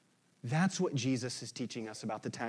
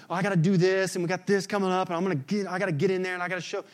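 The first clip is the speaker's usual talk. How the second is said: frantic striver; 4 semitones higher, 7 dB louder, much faster than usual. Retold or imitated. Mocking anxious status-seeker.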